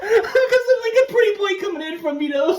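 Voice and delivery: high, giggling through the words; can barely get it out for laughing; laughing hard. Real, not acted.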